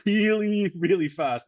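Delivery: both reallys punched high and loud; really, really pressed hard and high, then fast said loud; emphatic really fast. Real, not acted.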